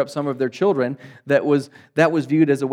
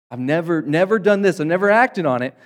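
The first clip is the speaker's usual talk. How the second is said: defensive protest; 4 semitones higher, 6 dB louder than usual. Retold or imitated. Defensive self-justifying quote.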